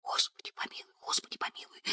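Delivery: very soft, frightened whispered prayer. A narrator's reading. Katya praying over the fainted girl, whispering. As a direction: whisper it, barely any voice. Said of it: "прошептала белыми губами Катя".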